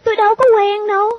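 high, quick denial; little girl denying. Acted. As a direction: deny it fast in a child's high voice. Girl denies knowing the man.

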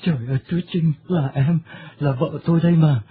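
sobbing. Acted. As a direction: break down weeping over his lost wife. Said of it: "khóc nức nở". (khóc nức nở, nghẹn ngào)

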